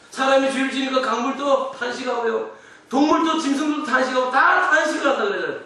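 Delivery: high, shouted sermon climax; full-force preaching. Real, not acted. Pastor proclaiming all creation groans, full-force preaching. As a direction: build to a loud, high-pitched proclamation.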